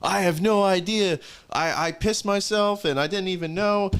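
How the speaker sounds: high, loud panicky voice of a hungover buddy; mock-panicked friend squeal; mimicking panicked drunk friend. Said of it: "He was like".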